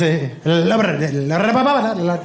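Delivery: gibberish imitation of Spanish, tongue rolling; mock Spanish. Son ordering from waiter, babbling mock Spanish. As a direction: babble fake Spanish with confidence. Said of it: "it sounds like this".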